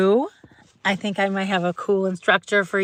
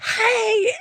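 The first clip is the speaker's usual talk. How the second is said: excited calls. Retold or imitated. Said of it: "she's just like".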